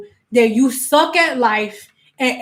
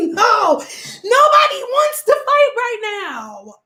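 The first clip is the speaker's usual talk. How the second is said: high, slow, crying wail; high, slow mock-crying backing down; mock panicked Aries; 11 semitones higher, slower than usual. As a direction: whimper like you're begging out of a fight. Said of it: "You be like".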